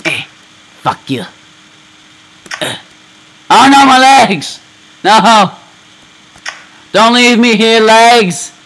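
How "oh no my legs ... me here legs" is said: loud, much higher hammed-up wail; mock anguished cry. Gamer voicing ragdoll losing legs, hammed-up wail.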